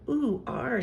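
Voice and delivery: child's playful pirate imitation; pirate talk. A narrator's reading. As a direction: try out a pirate's ooh-ar. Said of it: "talk like a pirate".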